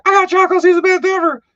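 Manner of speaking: high, loud gloating squeal. Retold or imitated. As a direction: crow about it like a kid who won. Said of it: "And he's like".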